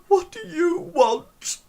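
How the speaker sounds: put-on high, slow falsetto of a weepy girl; tearful falsetto, dragging the words out. Weepy Erin answers a knock at her door, tearful. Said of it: "from all the, the crying".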